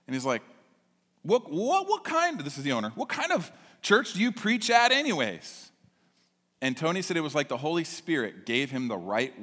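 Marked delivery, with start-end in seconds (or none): 1.2-2.4 much higher, sputtering what; stammering surprise. Retold or imitated. 2.9-5.7 higher, amazed asking; incredulous question. Retold or imitated. Owner's surprised question.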